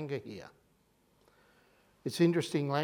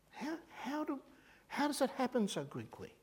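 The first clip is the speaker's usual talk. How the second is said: incredulous; 8 semitones higher, 7 dB quieter than usual. Real, not acted. Aghast rhetorical question.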